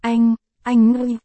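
put-on a child forcing out each word; small child straining to call his brother; struggling child voice. A narrator's reading. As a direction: push the words out with effort, halting. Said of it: "khó nhọc mở miệng".

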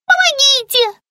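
very high cry for help; panicked squeak. A narrator's reading. Captive lizard caught by the snake, squeaking very high. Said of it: "пискнула пленница".

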